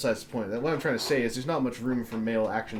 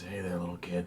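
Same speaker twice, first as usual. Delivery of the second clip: creepy voice; 7 semitones lower, 7 dB quieter than usual. Pretend. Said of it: "That creepy sound is".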